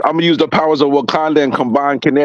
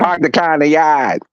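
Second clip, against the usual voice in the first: slow, R-less Boston accent; slower than usual. Shown as a demonstration. Offering an accent to friends, slow Boston accent. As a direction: drop the Rs like a Bostonian. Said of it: "What about Boston?".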